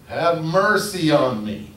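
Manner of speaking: high, loud desperate cry for help; shouting. Retold or imitated. Blind beggar shouting to Jesus from the roadside, loud plea. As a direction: call out over the crowd, pleading. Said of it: "he began to shout".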